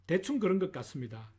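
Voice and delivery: normal talking voice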